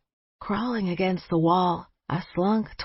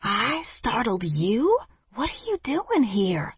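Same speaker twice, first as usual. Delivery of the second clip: higher, sharp, incredulous hiss; 5 semitones higher than usual. A narrator's reading. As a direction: throw his words back at him, annoyed. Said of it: "I hissed at him".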